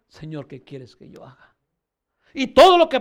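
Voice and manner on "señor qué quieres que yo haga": hushed Saul. Acted. Saul's words voiced in sermon.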